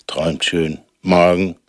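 tuck them in gently; bedtime story voice. Acted. Goodnight to children.

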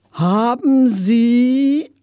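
drawn-out request. A narrator's reading. Customer starting a request to a young salesman, drawn out. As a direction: draw each word out slowly, pitch climbing. Narrator calls to salesman for help.

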